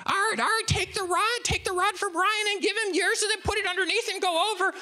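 very high, worked-up falsetto nagging; Linda. Retold or imitated. Wife shouts rod instructions at Art, worked-up shrieky imitation. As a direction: imitate a frantic woman piling up orders without a breath. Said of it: "Linda is just getting all worked up".

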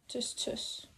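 whispered tuss tuss; whispering. Real, not acted. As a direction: breathe it out unvoiced. Cooing at the baby.

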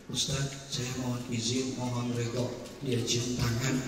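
very soft, low, humble request; humble hush. Acted. Young man humbly taking leave of the preacher, very soft. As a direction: ask leave quietly, with deep respect.

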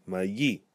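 "mayi" as ma-YEE, stress on the end; "mayi" with a stressed final yee; stress on final syllable. Shown as a demonstration. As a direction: lift the last syllable of "mayi". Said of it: "where we stress the final syllable".

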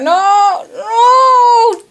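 long cry of dismay; dismayed wail. Real, not acted. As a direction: wail it as the chance slips away. Just missed the puzzle pieces.

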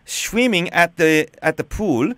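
hammed-up German accent, deliberate and emphatic; badly faked German caller; put-on German accent. Shown as a demonstration. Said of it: "with a German accent".

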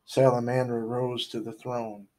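usual speaking voice

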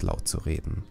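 plain reading voice

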